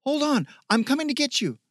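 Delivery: high, loud, quick rescue call; urgent calling out. A narrator's reading. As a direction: call urgently to someone in danger.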